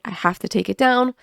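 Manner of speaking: ordinary talk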